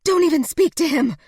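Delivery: high, loud angry outburst; furious scream. A narrator's reading. Jessica screaming at the woman who shot him, high and loud. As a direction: scream at her to shut up. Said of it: "Jessica screamed".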